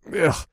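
short, high "ugh"; disgusted groan. Acted. Drinker still tasting the vile potion, disgusted groan. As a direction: recoil from a vile taste.

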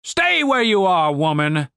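officer's ringing, drawn-out order; commander's order. A narrator's reading. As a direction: halt her with stern authority. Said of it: "said the commander".

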